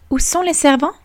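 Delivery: put-on a spoiled girl expecting service; princess asking.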